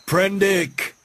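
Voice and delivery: high sharp call of the name; crying out. A narrator's reading. Moreau crying out to silence Prendick. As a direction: snap his name out. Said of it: "cried Moreau".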